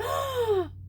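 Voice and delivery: sharp high gasp of wonder; retold gasp. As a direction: gasp as if struck by the view. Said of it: "I turned around and I went".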